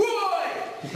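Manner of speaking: high-pitched drawn-out "Oi!"; calling out. Retold or imitated. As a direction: shout it like hailing someone from a window. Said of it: "Like this to me".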